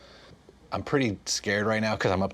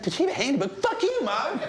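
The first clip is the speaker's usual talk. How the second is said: put-on kid voice. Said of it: "'cause he's like".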